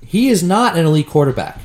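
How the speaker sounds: ordinary talk, nothing marked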